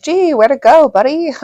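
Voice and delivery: sarcastic cheer at the cousin; sarcastic gee. Retold or imitated. Teasing the cousin after grandma shoved him, sarcastic. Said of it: "but I'm like".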